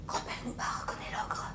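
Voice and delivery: low hushed whisper; low suspense whisper; tense whisper. A narrator's reading. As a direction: whisper the danger. Tense narration aside.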